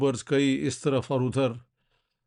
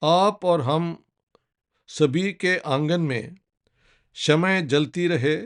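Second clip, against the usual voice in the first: slow, measured Urdu verse with pauses between phrases; poem recitation; 2 semitones higher than usual. Acted. Speaker reads Sahir's anti-war nazm to viewers, solemn recitation. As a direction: recite each line deliberately, letting it land. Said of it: "पढ़ के सुनाना चाहता हूँ".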